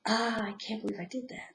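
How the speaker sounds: soft whisper of self-reproach; whispered inner voice. Pretend. Inner voice scolding oneself, whispered. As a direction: hush it like a guilty thought. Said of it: "And then that voice goes".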